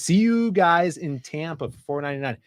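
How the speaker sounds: normal talking voice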